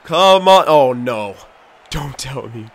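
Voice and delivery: usual speaking voice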